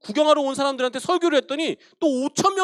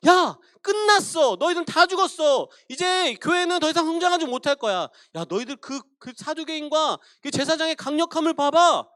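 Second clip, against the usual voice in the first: devil's taunting lies; 5 semitones higher than usual. Acted. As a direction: sneer at them like a gloating tempter. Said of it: "그 말들은 이런 거예요".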